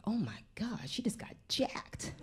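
stunned, voiceless whisper; shocked whisper. Retold or imitated. Parent witnessing another mother's slap, shocked whisper. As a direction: whisper it aghast, stretching it out.

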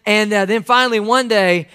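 normal talking voice

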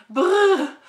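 loud high brrr shiver; shudder. Acted. Ad shivers at the hospital, loud brrr. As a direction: shiver with disgust.

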